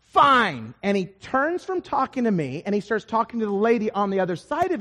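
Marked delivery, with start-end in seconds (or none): 0.0-0.7 loud, drawn-out indignant reply; offended, emphatic retort. Acted. Plane passenger asked again about reading it, loudly indignant. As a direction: refuse loudly, one slow word at a time. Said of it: "He goes".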